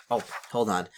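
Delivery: ordinary delivery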